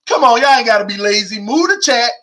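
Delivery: usual speaking voice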